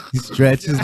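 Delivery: normal talking voice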